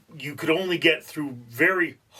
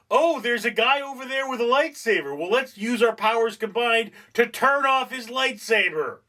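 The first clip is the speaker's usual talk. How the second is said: higher, chirpy Jedi teamwork voice; mock Jedi planning; 8 semitones higher than usual. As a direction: playfully voice the clever Jedi. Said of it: "They should be like".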